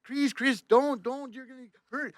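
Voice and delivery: high imitation of fretting French tourists; pleading Frenchmen. French tourists pleading with their driver to stop, high-pitched alarm. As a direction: beg him to stop in a high, put-on voice. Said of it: "all these French guys are coming over to me going".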